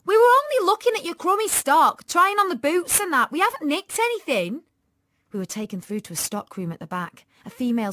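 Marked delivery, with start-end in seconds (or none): 0.1-4.6 high, loud defensive protest; loud, pitched-up girl's outrage; indignant yelling. Acted. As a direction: yell back at your accusers, easing slightly. Tanya keeps yelling in protest.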